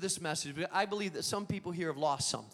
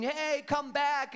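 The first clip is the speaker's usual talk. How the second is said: drawn-out call, raised high; mild mock call after a dog; 5 semitones higher, slower than usual. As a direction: call the runaway dog back, slow. Boys chasing the dog.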